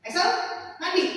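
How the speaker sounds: put-on curt command to a child; short, abrupt mom's order; old sharp tone. Shown as a demonstration. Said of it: "nada bicara saya kalau tadinya gini". (perintah ibu yang singkat dan ketus)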